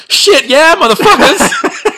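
high, loud gleeful crowing; gloating cheer. Retold or imitated. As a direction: crow in triumph, laughing. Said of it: "And now Abinadi's going".

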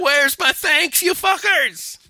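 high, drawn-out comic rant; mock outrage. Jesus to the lepers who never returned, mock outrage. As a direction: wail it slowly, high and indignant, as a joke.

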